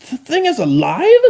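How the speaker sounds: high, loud astonished squeal; shocked disbelief. Acted. Chrysalis realising the machine talks, high and shocked.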